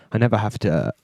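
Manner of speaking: ordinary talk, nothing marked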